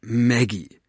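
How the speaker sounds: a father starting to admonish; understated parental reproach; familiar admonishing tone. A narrator's reading. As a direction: say the name as a mild warning. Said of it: "begann er in dem Tonfall, den sie nur zu gut kannte".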